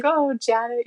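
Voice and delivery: lightly voiced eye-rolling protest; mock exasperation. Humble father to daughter who talks about him, mildly exasperated. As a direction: imitate a humble, good-natured groan at her. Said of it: "he kind of rolls his eyes and he's like".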